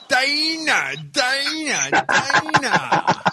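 high, drawn-out calls, each falling at the end; playful calling out. Pretend. Host summoning the special guest on the line, playful calling out. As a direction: sing out the name in a high, teasing summons.

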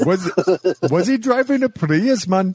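put-on high, hammy Italian accent; mock Italian cabbie accent. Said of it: "That was an Italian accent".

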